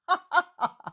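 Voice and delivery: high, mocking little laugh; scornful laugh. A narrator's reading. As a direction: laugh at her faith with scorn. Herodian scoffs at Mary.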